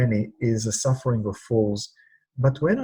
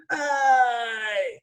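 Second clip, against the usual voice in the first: a higher, drawn-out "aye" of pain; pain cry; 10 semitones higher than usual. Shown as a demonstration. Leading the audience, a demo cry of pain. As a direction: show the audience how to cry out the ache. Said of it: "can you help me and do this with me".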